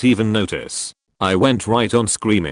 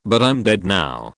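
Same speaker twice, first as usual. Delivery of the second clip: ordinary talk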